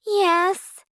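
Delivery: put-on high girlish voice, a touch sad; a young girl admitting she cried; sad Cinderella. A narrator's reading. Cinderella telling her godmother why she cried, high and a touch sad.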